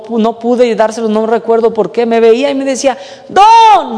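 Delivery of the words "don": high, loud drawn-out call; grito de llamada. Retold or imitated. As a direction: call out to get someone's attention. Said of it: "me gritaba". (gritado, llamando a alguien)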